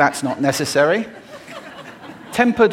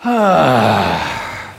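loud breathy exhaled sigh; 6 dB louder than usual. Shown as a demonstration. Coach demonstrating a vocal warm-up, big sigh. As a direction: let a full breath out on "hah". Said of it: "One more time".

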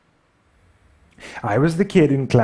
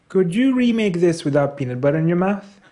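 higher put-on heckler voice; snide commenter; 6 semitones higher than usual. Commenter mocks the narrator's speech, high put-on voice. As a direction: imitate a mocking online critic, pitched up.

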